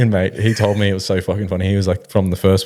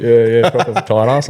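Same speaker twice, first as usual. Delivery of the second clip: usual speaking voice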